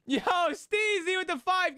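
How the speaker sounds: high, slow, excited call-out; hype shout-out. Real, not acted. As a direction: stretch it out like announcing a big play.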